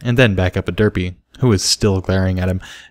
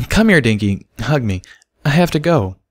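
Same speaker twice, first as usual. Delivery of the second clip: begging; 5 semitones higher than usual. A narrator's reading. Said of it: "begged Derpy".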